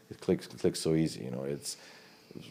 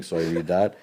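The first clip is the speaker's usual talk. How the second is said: usual speaking voice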